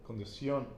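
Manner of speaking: condición with the weight on the final ción; stress on last syllable. Shown as a demonstration. Teacher showing learner where condición is stressed. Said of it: "the emphasis is on the O".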